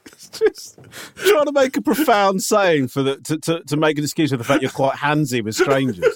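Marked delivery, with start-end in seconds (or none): 0.0-2.9 laughing so hard the words barely come, slow and high; real laughter. Real, not acted. Host breaks up at his friend's profound bridge saying, laughing. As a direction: speak through helpless giggles.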